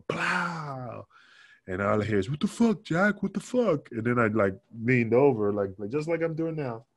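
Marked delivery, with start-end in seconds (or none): 0.1-1.1 drawn-out imitated bang, higher pitch; firecracker bang. Wrestler imitating the slap he heard, as a firecracker bang. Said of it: "it was like a, like a cra-, like a firecracker". 2.3-3.9 high-pitched retold disbelief; shocked outcry. Locker-room victim to Jack after a slap, high shocked outcry.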